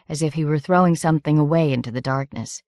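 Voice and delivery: plain reading voice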